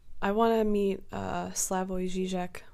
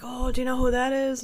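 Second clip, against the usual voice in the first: higher, louder mock excitement; retold gushing question, pitched up; excited imitation; 6 semitones higher, 5 dB louder than usual. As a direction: act out an excited bar-goer. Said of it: "somebody was really excited".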